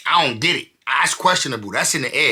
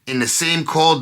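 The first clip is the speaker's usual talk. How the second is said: ordinary talk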